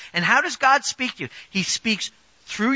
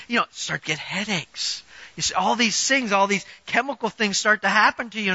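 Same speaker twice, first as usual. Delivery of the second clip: ordinary talk, nothing marked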